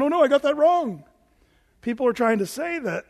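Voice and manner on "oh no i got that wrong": higher, louder mock dismay in a put-on voice; mock frustrated God. Preacher mocking the idea of God changing his mind, in a high, loud put-on voice. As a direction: ham up a flustered God admitting a mistake. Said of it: "God's really frustrated going".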